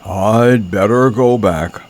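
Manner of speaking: slow, put-on bear voice; slow-witted bear working it out; Pooh's voice. A narrator's reading. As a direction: say it slowly as a storybook bear. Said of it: "said Pooh".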